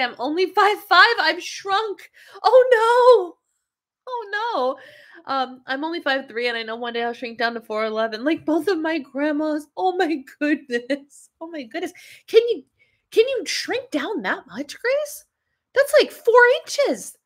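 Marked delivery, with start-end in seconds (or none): 2.4-5.3 high, loud dismayed cry. Real, not acted. Reacting to a viewer who shrank, high dismay. 9.7-11.9 shocked. Real, not acted. Reacts to shrinking news. 12.3-17.2 much higher, slow disbelieving question; incredulous. Real, not acted. Asking Grace in disbelief about shrinking, high and astonished.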